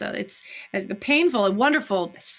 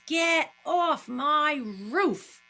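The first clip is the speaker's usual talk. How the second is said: acted Daisy; 5 semitones higher, much slower than usual. Acting Daisy, slow and cold.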